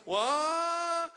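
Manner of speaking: a long, very high, stretched-out cry of disbelief; long drawn-out what. Retold or imitated. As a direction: hold the vowel up high in shock. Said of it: "ten thousand A's".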